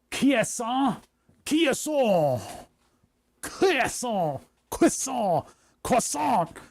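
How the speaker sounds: put-on croissant said the French way, high and drawn out, French accent. Showing his viewers a mock French accent. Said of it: "French accent".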